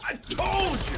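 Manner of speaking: high, loud told-you-so; I told you, shouted high; gloating shout. Real, not acted. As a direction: crow it triumphantly. Gloating over predicted unconcession.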